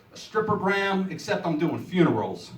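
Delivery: ordinary talk, nothing marked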